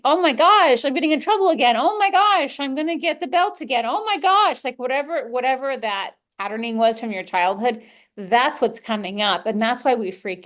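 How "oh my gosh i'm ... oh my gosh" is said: high, louder mock panic; a child fearing punishment, high and rising; panicking child. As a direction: spiral like a scared kid in trouble. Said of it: "it's because they think".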